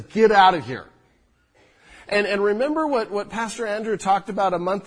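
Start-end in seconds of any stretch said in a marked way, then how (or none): 0.0-0.8 a sharp, mock-angry get out; angry rebuke. Pastor voicing disciples shooing people away, mock angry. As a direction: snap it like a scolding disciple. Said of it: "a strong, angry word that says".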